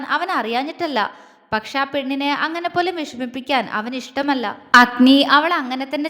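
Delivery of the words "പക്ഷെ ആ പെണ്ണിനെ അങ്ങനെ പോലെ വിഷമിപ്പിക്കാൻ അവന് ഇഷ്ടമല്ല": usual speaking voice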